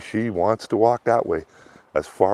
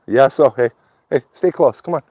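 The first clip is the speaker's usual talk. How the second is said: calling to dog; 6 semitones higher, 7 dB louder than usual. Real, not acted. Calling his dog to stay close.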